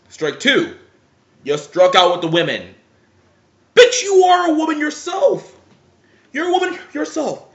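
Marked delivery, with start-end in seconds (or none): 3.7-5.5 high, loud outraged shout; outraged yell. Real, not acted. Ranting vlogger yells at the blogger, outraged. 6.3-7.5 high, indignant repeat of the jab. Real, not acted. Vlogger repeats the jab at the blogger, indignant.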